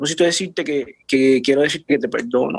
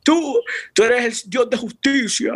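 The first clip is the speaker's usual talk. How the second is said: higher and slower, a reenacted prayer; retold prayer to God; 7 semitones higher, slower than usual. Son recounts praying that God is just, higher and slower. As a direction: lift the pitch and slow down, declaring God just. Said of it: "Yo dije".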